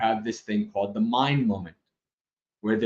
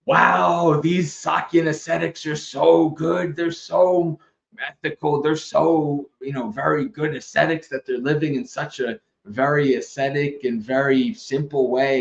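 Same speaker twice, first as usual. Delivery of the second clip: higher, awestruck voice of a naive admirer; over-the-top impressed husband; gushing husband; 3 semitones higher than usual. Retold or imitated. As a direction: gush like a starry-eyed husband praising monks. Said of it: "this husband is in the cave and he's like".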